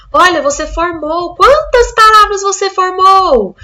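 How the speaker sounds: upbeat classroom coaxing; cheerful teacher voice. Shown as a demonstration. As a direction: praise the child and ask eagerly. Teacher questions child in demo.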